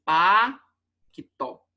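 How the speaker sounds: "paquitó" as a long pa, then qui-tó; wrong two-part split. Shown as a demonstration. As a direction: break "paquitó" after pa, with a pause. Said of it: "também não podia ser".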